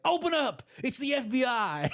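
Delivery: high-pitched shout imitating a kid's raid; mock FBI raid. Dad imitating his son slamming the door, high mock shout. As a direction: burst in like a pretend agent. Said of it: "slam the door and be like".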